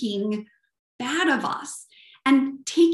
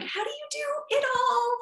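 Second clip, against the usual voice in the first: squeaky-high imitation of an admirer's question, softer than usual; imitated question pitched way up high; 14 semitones higher, 3 dB quieter than usual. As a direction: put on a very high, sing-out mock voice. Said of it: "my high-pitched voice".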